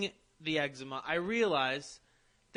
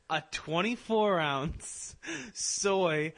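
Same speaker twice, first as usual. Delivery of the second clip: slow, over-clear order; much slower than usual. Retold or imitated. Customer repeating his order to the barista, very slow and pointed. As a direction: drag each word out like to a slow listener.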